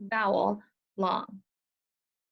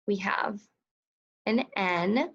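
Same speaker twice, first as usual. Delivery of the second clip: ordinary talk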